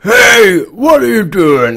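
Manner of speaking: high, loud, slow monster shout; super mutant voice. Retold or imitated. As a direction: bellow it like a hulking mutant. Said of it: "that's me super mutant voice".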